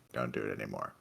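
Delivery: low, quiet annoyed cat voice; grumpy cat voice. Retold or imitated. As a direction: voice the cat grumbling. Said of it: "before they're like".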